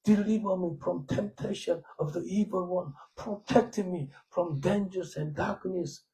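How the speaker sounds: a preacher showing how he prays in English, slow and hushed; English prayer. Retold or imitated. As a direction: pray it slowly in a hushed, lifted voice. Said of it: "영어로 그래".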